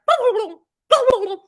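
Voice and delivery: high, loud turkey gobble. Retold or imitated. Excited kid gobbling at Disney, turkey impression. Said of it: "a pretty good turkey impression".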